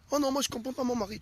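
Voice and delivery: high, quick protest as a wife; complaining wife. Acted.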